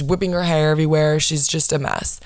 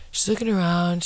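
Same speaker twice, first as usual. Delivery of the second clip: ordinary talk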